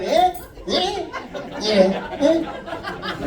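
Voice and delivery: high, soft mock elder grunts. Comic imitates the elder at the press conference, high soft grunts. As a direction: mimic a tiny old woman nodding along. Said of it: "he's standing going".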